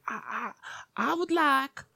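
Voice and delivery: put-on high, halting old-lady voice; old lady customer. Old lady ordering at the counter, high mock customer voice. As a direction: imitate a fussy customer in a high pitch. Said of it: "This old lady comes up".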